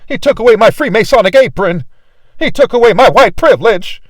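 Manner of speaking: mock the wrong reading with scorn; mocking imitation. Preacher mocking a shallow reading, sneering and loud. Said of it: "He's not saying".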